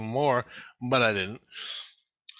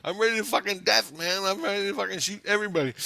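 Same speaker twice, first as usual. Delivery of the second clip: high, loud, heated retelling; impassioned high-pitched yelling; worked-up outburst; 8 semitones higher, 4 dB louder than usual. Retold or imitated. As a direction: rant at full tilt, pitched way up. Retold outburst, ready to fight.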